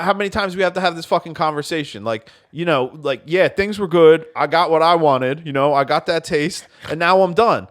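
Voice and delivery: hammed-up furious phone rant; mock angry rant. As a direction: snap at the caller like a jerk ex. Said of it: "And I'm like".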